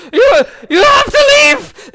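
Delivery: high, loud, slowed cry to leave; panicked shout. Acted. Shopkeeper screaming at the party to leave. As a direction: scream them out of the shop. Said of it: "she's like".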